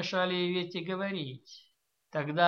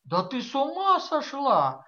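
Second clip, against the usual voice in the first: bewildered repeat; 8 semitones higher, 4 dB louder than usual. A narrator's reading. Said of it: "повторила она".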